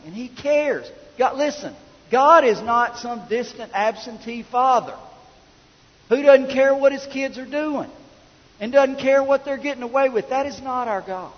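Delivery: high, insistent sermon delivery; impassioned preaching. Real, not acted. Preacher urging church to honor the Father, impassioned. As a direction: drive each line home from the pulpit.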